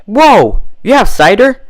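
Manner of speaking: high, loud burst of delight; excited interjection. A narrator's reading. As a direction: jump in thrilled at the news. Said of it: "Rainbow Dash interjected".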